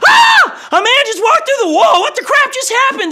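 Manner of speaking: hammed-up panicked shout, very high and loud; freaking out. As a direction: shriek it like startled disciples in a mock panic. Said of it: "They're freaking out".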